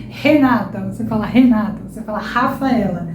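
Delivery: Renata and Rafaela with an h-like R at the start; strong R sound. Shown as a demonstration. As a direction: open Renata and Rafaela with an aspirated h. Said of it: "É o mesmo som".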